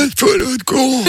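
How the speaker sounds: speaking on an in-breath; talking while inhaling. Shown as a demonstration. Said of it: "tu dois parler en inspirant".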